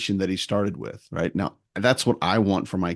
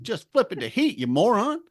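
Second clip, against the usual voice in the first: higher, mock exasperated; imitating his own annoyed thought; exasperated aside; 11 semitones higher than usual. Repairman to clueless kids, exasperated. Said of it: "I'm like".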